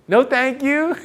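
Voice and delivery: high, mincing comeback with rising end; mimicking her refusal. Suitor repeating her own refusal back to her, in high mimicry. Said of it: "say the same thing she said to me".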